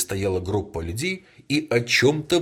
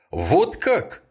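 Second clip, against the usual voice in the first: in one voice; 8 semitones higher, 4 dB louder than usual. A narrator's reading. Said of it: "в один голос сказали полицейские".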